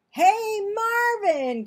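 high, loud call up to a friend; excited cry. A narrator's reading. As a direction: shout up in surprise. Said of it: "cried Nick".

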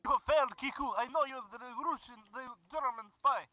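hammed-up Russian accent, broken English; mock Russian accent, gamer joking; fake Russian accent. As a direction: play a comic Russian comrade in a Russian accent. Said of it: "tired of doing the voice".

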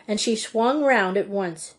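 plain reading voice

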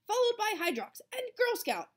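high, loud race commentary; sports announcer voice. A narrator's reading. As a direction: call the runners-up like a sportscaster. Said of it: "in her sports announcer voice".

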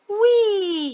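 high, loud squeal of joy; gleeful scream. Acted. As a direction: squeal like a child on a slide. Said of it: "he screamed".

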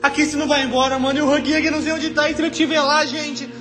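high, crying, panicked shouting; scared horror game streamer. Real, not acted. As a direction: scream in real terror, voice breaking. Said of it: "eu tô encurralado".

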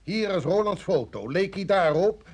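loud, rising rebukes; angry dressing-down. Acted. Chief confronting the rookies with the photo, loud and angry. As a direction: tear into the rookies.

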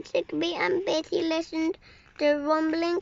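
ordinary delivery, nothing marked

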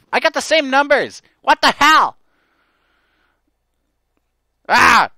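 loud, high outraged yell; furious shout. Real, not acted. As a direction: explode at the bad luck. Lost lottery again.

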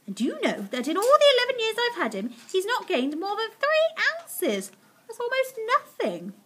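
high-pitched, earnest lament; fretting pet owner. Acted. Missus Silver complaining of Alfie's growth, high dismayed voice.

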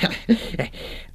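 a short airless laugh; breathy chuckle. Acted. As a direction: chuckle under the breath, pleased. Critic relishing the final selection.

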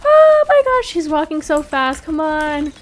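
very high, loud panicked gasp; panicked shriek. Real, not acted. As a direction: shriek in sudden fright. Giant chasing her.

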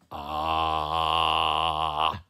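loud sustained "Ah"; long loud ah. Acted. Sly Mister Cat showing Missus Chicken how to say ah, loud drawn-out. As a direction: hold a big open "Ah" like at the doctor.

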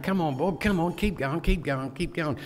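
high-pitched, held-back shout; mock yelled encouragement. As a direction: urge a tired mate on, repeating it like a chant. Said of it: "he's yelling out".

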